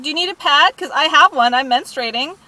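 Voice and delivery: high, loud mock offer; retold taunt. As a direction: throw the sarcastic offer in the culprit's face.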